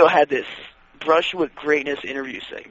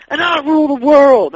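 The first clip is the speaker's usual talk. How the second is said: wrestler promo voice; 10 semitones higher, 7 dB louder than usual. Retold or imitated. Quoting bad wrestler promo.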